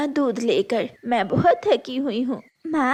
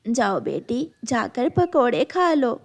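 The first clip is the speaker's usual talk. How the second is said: ordinary delivery, nothing marked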